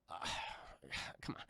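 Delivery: fast breathy whispered groan; exasperated whisper. Retold or imitated. Husband doubting his wife, whispered groan. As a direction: sigh it out in disbelief.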